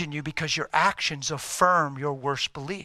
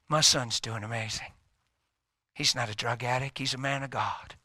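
normal talking voice

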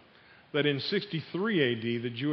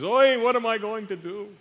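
higher, louder mock lament; dismayed poor pilgrim; 7 semitones higher, 6 dB louder than usual. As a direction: wail it like a hard-luck customer in a comic story. Acted dismay of poor lamb owner.

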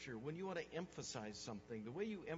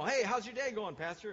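loud, high, breezy hello; hearty acted greeting, thrown out loudly; friendly passer-by greeting; 6 semitones higher, 10 dB louder than usual. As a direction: imitate someone hailing their pastor. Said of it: "they'll say".

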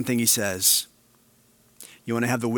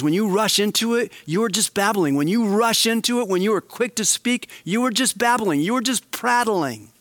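much higher, urgent warning voice; James scolding; 8 semitones higher than usual. Retold or imitated. James warning hasty speakers, higher pressing voice. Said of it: "He goes".

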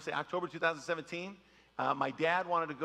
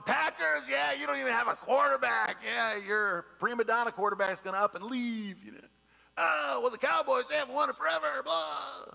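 high, loud teasing rival-fan voice; mocking Packers fan; 7 semitones higher, 4 dB louder than usual. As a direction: rib a friend about his team, pitched up. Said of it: "I'm, like, mocking him".

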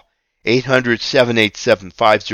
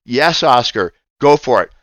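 ordinary talk

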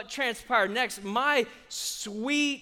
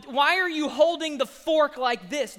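ordinary delivery

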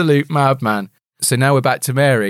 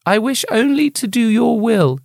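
higher, lightly voiced meek young woman; Mary's voice; 9 semitones higher than usual. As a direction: read it like a dutiful daughter. Mary quoted from the book.

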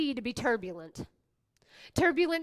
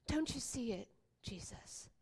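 hushed plea; 3 semitones lower, 13 dB quieter than usual. Acted. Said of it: "crying out in desperation".

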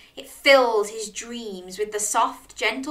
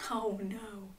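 hushed, whispered oh no; whispered dismay; 13 dB quieter than usual. Pretend. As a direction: whisper it as a worried aside to children. Aside on crab's short legs.

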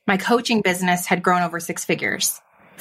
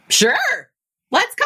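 jumps way up in pitch, a little louder; a thrilled, instant yes to the offer; eager yes; 12 semitones higher, 3 dB louder than usual. Retold or imitated.

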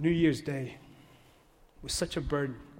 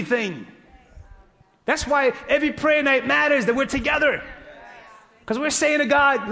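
louder, higher preacher cadence driving the point; preaching at full force; 5 semitones higher, 6 dB louder than usual. Acted. Preacher urging his congregation, full-force preaching. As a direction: preach it hard to the church.

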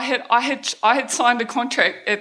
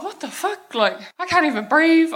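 high-pitched, incredulous protest; retold panicked disbelief; 7 semitones higher than usual. Pageant queen brushed off by manager during panic attack, retold disbelief. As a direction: relive the panic in a much higher voice.

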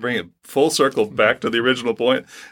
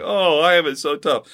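higher-pitched whiny complaint; mock whining; 5 semitones higher than usual. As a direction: imitate someone feeling sorry for himself. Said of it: "whining and complaining".

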